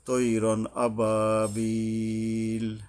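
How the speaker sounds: slow chant of "তৈরন আবাবিল", last i held; sustained tajweed "তৈরন আবাবিল"; recited long madd. Shown as a demonstration. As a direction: recite "তৈরন আবাবিল", stretching "বিল" before stopping. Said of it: "তিন আলিফ টানে থামতে হবে".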